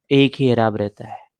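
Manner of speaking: normal talking voice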